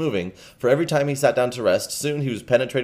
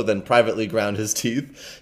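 plain reading voice

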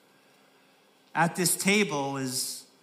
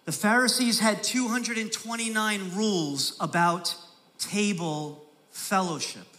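normal talking voice

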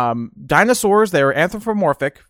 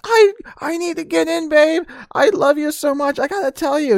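very high whiny sob-voice; hammed-up blubbering begging; mock crying plea; 13 semitones higher than usual. Boyfriend begging to be let in, high sobbing.

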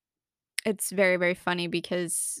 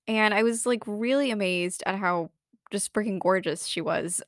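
usual speaking voice

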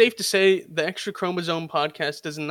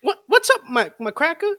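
mock high taunt; 10 semitones higher than usual. Repeats taunt mockingly.

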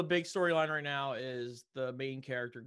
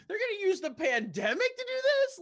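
indignant disbelief; 5 dB louder than usual. Real, not acted. Outrage over character's death.

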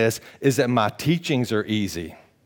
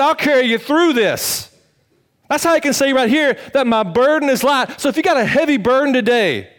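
rising higher and louder into a preaching climax; building to full preaching; 11 semitones higher, 6 dB louder than usual. Acted. Pastor promising the congregation Christ carries them, building loud. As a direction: lift the church up, climbing in pitch.

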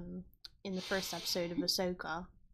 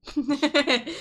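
creature cackle; 6 semitones higher, 11 dB louder than usual. Retold or imitated. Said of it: "sits there and laughs all the time".

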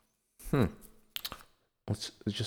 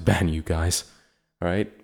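normal talking voice

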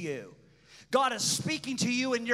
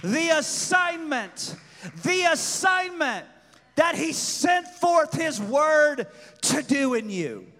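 loud, higher sermon build; preaching at full force; 4 semitones higher, 5 dB louder than usual. Acted. Preaching climax.

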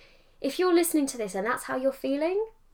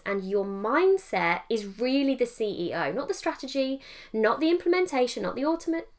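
ordinary talk, nothing marked

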